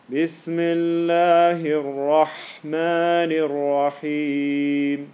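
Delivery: very slow, sustained chant; lazy recitation. Shown as a demonstration. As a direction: let each long vowel roll out unhurried. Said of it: "Can you see that?".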